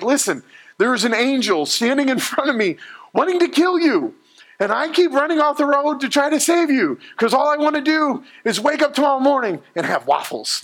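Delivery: high, squeaky cartoon donkey pleading; talking donkey voice. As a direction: play the donkey as a goofy, frantic sidekick. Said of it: "the donkey's like".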